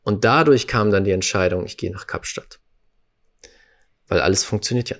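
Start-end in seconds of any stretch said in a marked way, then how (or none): 1.7-2.6 whispered decision. Retold or imitated. Leise Entscheidung, fast geflüstert.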